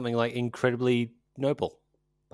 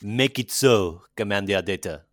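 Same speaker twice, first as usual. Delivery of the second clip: put-on Steve Martin's French accent; 3 dB louder than usual. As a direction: do a comically bad French starship captain. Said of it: "Steve Martin to do his French accent".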